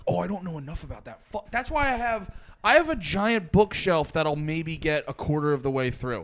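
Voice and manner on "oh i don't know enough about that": quick self-talk. Retold or imitated. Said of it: "constantly being like".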